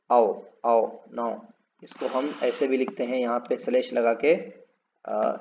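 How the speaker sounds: ordinary talk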